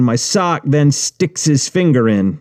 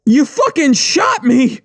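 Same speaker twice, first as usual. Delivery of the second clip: high slow shriek of outrage; scream; slower than usual. Acted. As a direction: cry it out in shock. Retold scream in narration.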